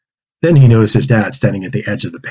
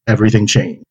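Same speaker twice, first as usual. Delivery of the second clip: usual speaking voice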